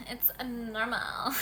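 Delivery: it's a normal whispered slowly. Shown as a demonstration. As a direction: hush it into a slow whisper.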